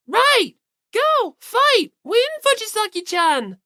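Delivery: high, loud cheerleader chant; cheering. Acted. Asahina cheering Fujisaki on, high loud cheer. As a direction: shout it like pom-poms are flying. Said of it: "cheered Fujisaki on".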